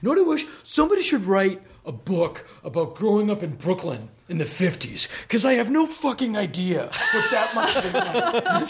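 high-pitched sarcastic mock rant; imitated shouting to himself. Imitating Bill ranting at the family table, mock shouting. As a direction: start high and burst out on the swearing. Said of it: "While shouting to himself".

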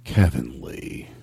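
very low, slow name drop; low solemn take. Shown as a demonstration. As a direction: drag it out way down low.

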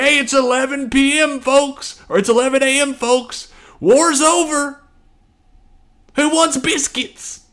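very high, chipper call, slowing at the end; cheery mock announcer. Officers telling soldiers the war just ended, chirpy mock announcement.

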